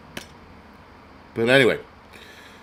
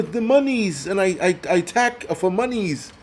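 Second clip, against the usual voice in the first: mock Ukrainian accent; 5 semitones higher than usual. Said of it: "his Ukrainian accent".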